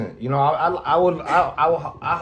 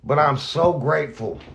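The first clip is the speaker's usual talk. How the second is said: choked up. Real, not acted. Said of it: "I'm gonna tear up".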